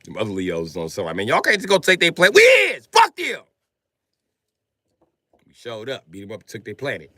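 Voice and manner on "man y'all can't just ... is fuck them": high, loud triumphant shout; defiant yell. Acted. Leos arguing over seizing Mars, high loud defiant yell. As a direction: bellow it like a battle cry.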